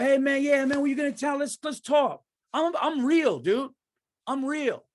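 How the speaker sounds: high, over-friendly impression of an industry phony; fake LA schmoozer. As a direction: imitate a phony new pal pitching sincerity. Mimics fake people met in LA.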